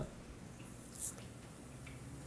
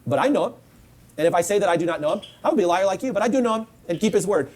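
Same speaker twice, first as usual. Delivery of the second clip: usual speaking voice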